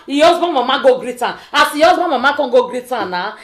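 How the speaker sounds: ordinary talk, nothing marked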